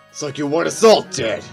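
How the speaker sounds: loud, high accusing shout; angry outburst. Acted. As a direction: explode at them in the argument. Angry courtroom quarrel.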